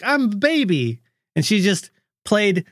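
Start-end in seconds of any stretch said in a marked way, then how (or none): 0.0-1.0 put-on high, cutesy child voice; little sister voice. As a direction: play the toddler happily. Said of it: "She's like".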